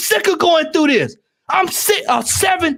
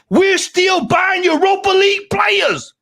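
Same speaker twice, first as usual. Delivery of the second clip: angry shout; 6 semitones higher, slower than usual. Real, not acted. Fan venting at the panel about signings, angry shouting. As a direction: shout "eighth place players" slowly.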